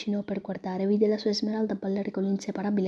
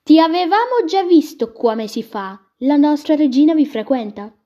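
much higher, much louder and slow, a booming showman; 7 semitones higher, 11 dB louder than usual. Acted. Che disse.

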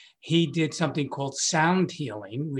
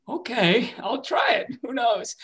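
game shrug; 8 semitones higher, slower than usual. Retold or imitated. Agreeing to wife's idea.

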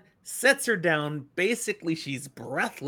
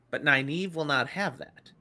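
normal talking voice

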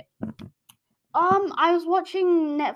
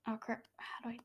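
whispering; 17 dB quieter than usual. Real, not acted. Quiet aside to self.

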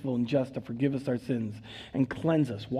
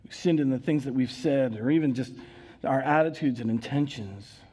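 prayer. Acted. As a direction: lead the congregation in confession. Leads a prayer of confession.

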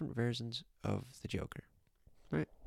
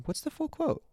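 normal talking voice

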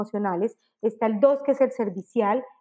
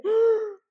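high voiceless gasp of fright; gasp, speechless with shock; mock startled gasp, pitched high; 10 semitones higher than usual. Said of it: "nos quedamos sin voz".

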